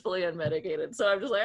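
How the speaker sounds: usual speaking voice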